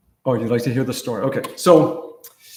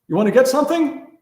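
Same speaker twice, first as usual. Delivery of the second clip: sweet student voice; 9 semitones higher, 4 dB louder, faster than usual. Retold or imitated. Student testing him, sweetly.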